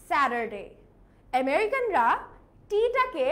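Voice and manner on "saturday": American Saturday, T softened to D; American accent. Shown as a demonstration. Teacher contrasting with British, American accent. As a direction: lower American "sa-der-day" with sounded R. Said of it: "in American accent it will be".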